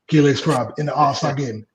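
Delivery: ordinary talk, nothing marked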